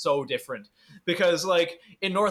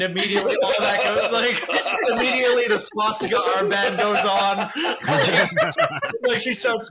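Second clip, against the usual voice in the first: higher, louder, laughing as he tells it; 5 semitones higher, 4 dB louder than usual. Real, not acted. Son mocking his mother's hypocrisy, laughing hard. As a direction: crack up while mocking mom.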